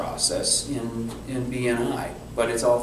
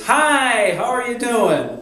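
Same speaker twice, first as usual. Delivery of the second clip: much higher, louder salesy hello; slick caller; 11 semitones higher, 6 dB louder, slower than usual. Retold or imitated. Telemarketer greeting Ruth on the phone, in a mocking high sales voice. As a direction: oversell the friendliness like a cold-caller.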